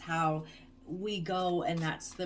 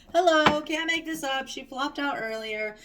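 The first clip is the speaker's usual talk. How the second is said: excited; 8 semitones higher, 7 dB louder than usual. Real, not acted. Card she predicted shows up.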